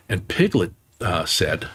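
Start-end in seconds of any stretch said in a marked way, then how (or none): none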